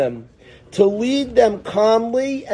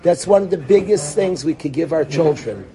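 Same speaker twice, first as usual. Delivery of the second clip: ordinary talk, nothing marked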